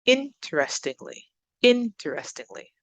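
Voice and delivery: interestingly with IN lifted and punched; careful demo of IN-terestingly; stress on first syllable. Shown as a demonstration. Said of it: "up on the first".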